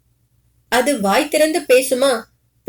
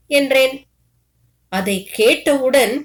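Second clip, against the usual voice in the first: ordinary delivery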